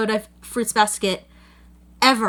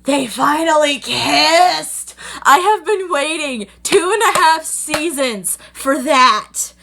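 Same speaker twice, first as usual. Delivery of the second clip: high, loud and drawn out with delight; thrilled squeal; 5 semitones higher, 5 dB louder, slower than usual. Real, not acted. Fan celebrating the long-awaited kiss, slow, high squeal. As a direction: gush about the kiss you waited seasons for.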